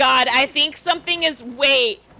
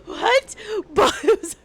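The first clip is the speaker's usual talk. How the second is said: giggling; 5 semitones higher than usual. Real, not acted. Giggling at cult joke.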